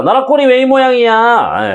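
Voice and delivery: high, indignant complaint, rising on 왜; exasperated outburst. Pretend. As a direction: throw up your hands at the country. Title line voiced as outburst.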